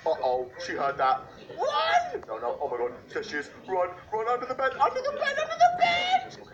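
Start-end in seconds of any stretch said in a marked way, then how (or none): 1.6-6.4 panicked high yelling; frantic shouts while fleeing; being chased. Real, not acted. Fleeing granny.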